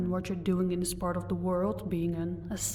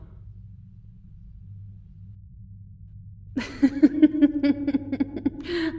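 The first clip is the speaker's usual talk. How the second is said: mocking laugh; 7 semitones higher, 3 dB louder than usual. Vampire mocks the elf.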